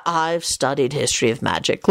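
low, irritable interruption. A narrator's reading. Lupin cuts off Scully's boast, irritably. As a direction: cut in low, mildly irritated. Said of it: "Lupin informed Scully irritably".

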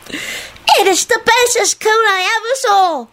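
put-on very high, slow child's squeal; happy scream. A narrator's reading. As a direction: squeal happily, drawing out the words. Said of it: "Natalie screamed happily".

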